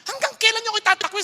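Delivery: heated divine rebuke; angrier God. Retold or imitated. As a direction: repeat the question with rising anger. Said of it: "sabi ng Lord".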